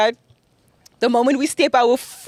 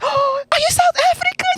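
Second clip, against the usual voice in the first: imitating a squealed question, much higher; excited high squeal. She imitates herself asking a friend, squealing. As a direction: squeal it like spotting a fellow South African. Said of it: "And then I was like".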